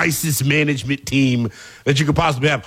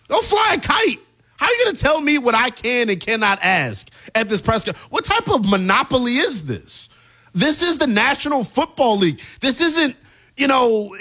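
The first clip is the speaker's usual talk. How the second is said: indignant rant; 6 semitones higher than usual. Pretend. Mock Patriots PR rant.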